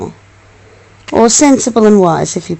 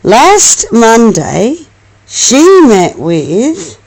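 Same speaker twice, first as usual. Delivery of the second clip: ordinary delivery, nothing marked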